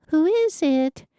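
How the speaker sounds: high, lilting sing-song question. A narrator's reading. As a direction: call it out in a playful sing-song. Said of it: "asked in a sing-song voice".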